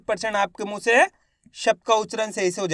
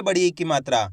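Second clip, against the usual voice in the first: usual speaking voice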